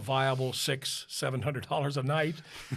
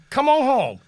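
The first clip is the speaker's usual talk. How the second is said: higher and louder; mock husband call; 10 semitones higher, 11 dB louder than usual. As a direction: call her home with a grin. Husband calling her home.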